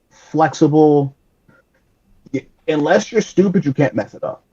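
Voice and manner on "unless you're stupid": normal talking voice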